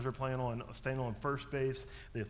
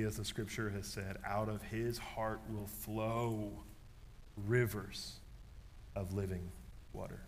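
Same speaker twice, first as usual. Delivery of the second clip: scripture reading; 3 semitones lower, 5 dB quieter than usual. Acted. Reads scripture slowly.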